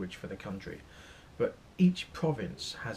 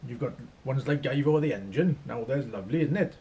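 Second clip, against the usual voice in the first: hammed-up singsong Welsh; exaggerated Welsh lilt; Welsh accent; 2 semitones higher, 6 dB louder than usual. Shown as a demonstration. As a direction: do a shaky attempt at Welsh, lilting and loud. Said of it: "Accents I find difficult is, uh, Wales".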